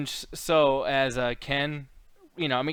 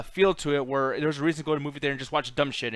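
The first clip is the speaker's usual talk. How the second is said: ordinary delivery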